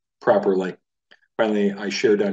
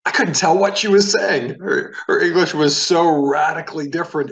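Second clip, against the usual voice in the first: pitched way up and louder, reliving the confusion; recalling a baffling phone call, much higher and louder; exasperated disbelief; 8 semitones higher, 5 dB louder than usual. Real, not acted. Teacher recalling a phone call, in high loud disbelief. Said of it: "which was scary".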